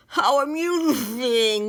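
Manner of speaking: sputtered out past a stuck-out tongue; spluttering. Acted. Willie, tongue stuck to the bug, sputters at his friend. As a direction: spit the words round a tongue full of bug. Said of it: "Willie spluttered".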